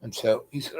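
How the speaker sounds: ordinary talk, nothing marked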